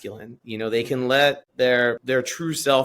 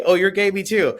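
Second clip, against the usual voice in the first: higher, louder, breezy fake enthusiasm; mock cheery reply; 7 semitones higher, 5 dB louder than usual. Teacher imitating a reply she'd never give a student, mock cheery. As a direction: toss it off as a too-eager confession.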